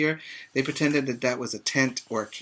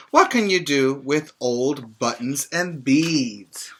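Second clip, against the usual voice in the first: bright, louder storybook read-aloud; reading to children; 2 semitones higher, 6 dB louder, slower than usual. Acted. Teacher reading a picture book to her pre-K class, lively read-aloud.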